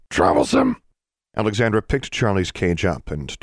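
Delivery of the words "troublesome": harsh squawking bird voice. A narrator's reading. Caged bird complaining at its owner's feet, loud squawk. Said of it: "Charlie squawked".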